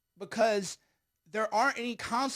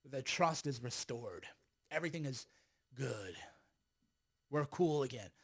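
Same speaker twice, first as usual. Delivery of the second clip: mock soothing; 4 semitones lower, 7 dB quieter than usual. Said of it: "And the fisa court will say".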